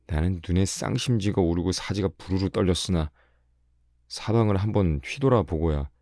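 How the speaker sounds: ordinary delivery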